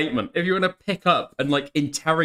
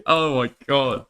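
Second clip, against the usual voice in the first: usual speaking voice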